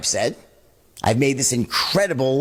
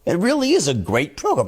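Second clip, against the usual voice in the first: mirroring their certainty; 6 semitones higher than usual. Shown as a demonstration. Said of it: "mirror back their own level of certainty".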